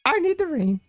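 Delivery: mimicking her high and petulant; mocking her. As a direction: squeak it out mocking her demand. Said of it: "she just went all like".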